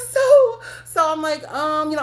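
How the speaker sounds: high and loud, crying with laughter; laughing near tears. Real, not acted. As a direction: laugh until it breaks. Laughing cry heard.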